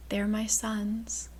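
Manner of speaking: slow breathy whisper. Acted. Lydia whispers to the boy about her sons. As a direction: let it slip out as a hushed confession. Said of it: "Lydia whispered".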